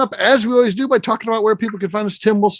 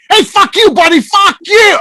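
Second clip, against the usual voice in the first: higher, louder angry shout at a honking car; mock furious yell; 10 semitones higher, 7 dB louder than usual. Retelling McNeil's road rage at a honk, hammed-up yell. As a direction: bark it back like road rage.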